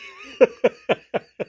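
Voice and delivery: a high, sudden burst of disbelieving laughter; high laugh that cracks out of disbelief. Real, not acted. Laughing at the clip.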